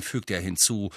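ordinary delivery, nothing marked

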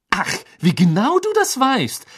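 high, loud sarcastic retort; biting indignation. A narrator's reading. Hermine retorts to Ron's disbelief, with biting indignation. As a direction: throw it back at him, stung. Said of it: "ihre Augen blitzten gefährlich".

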